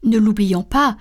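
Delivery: ordinary delivery, nothing marked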